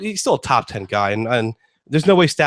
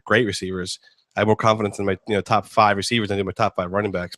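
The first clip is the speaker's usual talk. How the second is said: ordinary talk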